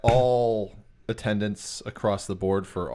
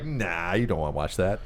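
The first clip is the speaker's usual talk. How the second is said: TV refusing in a lower, dismissive voice; lower mock TV voice; 8 semitones lower, 3 dB quieter than usual. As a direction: talk down like a smug TV. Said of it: "it was like".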